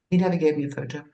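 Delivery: ordinary talk, nothing marked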